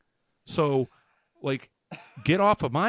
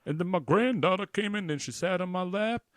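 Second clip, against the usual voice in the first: mock singer; 6 semitones higher, 4 dB louder than usual. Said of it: "is like".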